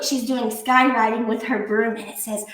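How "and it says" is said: ordinary delivery, nothing marked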